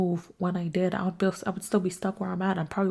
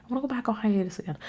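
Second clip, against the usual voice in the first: fed-up inner voice; 6 semitones higher than usual. Retold or imitated. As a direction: drag out the frustration, then rush the rest. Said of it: "and I'm like".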